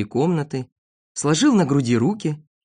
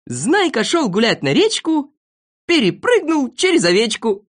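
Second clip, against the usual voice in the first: high-pitched, proud recital of a silly rhyme; reciting a verse; 7 semitones higher, 4 dB louder than usual. Acted. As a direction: declaim the couplet line by line. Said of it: "Вот сначала стихи про Знайку". (декламирует стишок)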